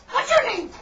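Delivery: very high, loud falsetto chicken; piercing cartoon chicken voice; chicken character. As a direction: squeal it out as a perky little chicken. Voicing chicken in joke.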